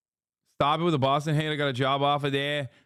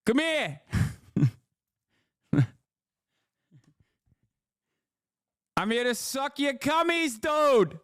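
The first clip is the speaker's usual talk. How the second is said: crude Boston bro; 11 semitones higher than usual. Pretend. Streamer clowning for chat, crude Boston bro voice.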